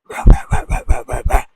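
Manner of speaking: loud, noisy imitated barks; pitchless, forceful dog barks; dog barking. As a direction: bark harshly and repeatedly. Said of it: "And the dog keeps".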